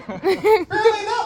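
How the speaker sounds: high, loud disbelief; mock outrage. Real, not acted. Comic scolds silent audience, loud high disbelief. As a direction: yelp it high and loud at the crowd. Joke fails, complains to audience.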